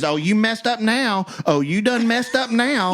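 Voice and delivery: high-pitched taunt, imitating her; mocking girlfriend. Man imitating the angry girlfriend at traffic, mock taunt. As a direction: mimic a woman's sing-out threat. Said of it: "his girlfriend also gets out of the car".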